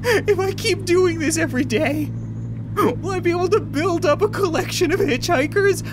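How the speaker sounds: high, giddy, half-laughing; giddy amusement. Real, not acted. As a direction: squeal it through rising laughter. Giddy over trapped hitchhikers.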